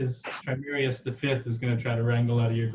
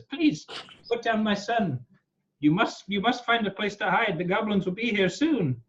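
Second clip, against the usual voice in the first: high, urgent pleading creature; pleading kobold father; 11 semitones higher than usual. Acted. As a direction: beg them to put the child down. GM voices kobold Trimerius.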